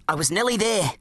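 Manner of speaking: usual speaking voice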